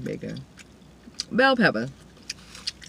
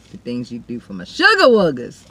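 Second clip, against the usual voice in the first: low and sweet, said for her fans; lower, cooing voice; 9 semitones lower than usual. Shown as a demonstration. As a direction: drop the voice and coo the words.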